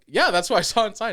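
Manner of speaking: fast, loud star-struck boast; excited blurt. Retold or imitated. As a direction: rush it out, thrilled. Telling driver about celebrity.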